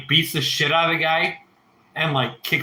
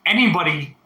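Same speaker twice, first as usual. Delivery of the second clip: normal talking voice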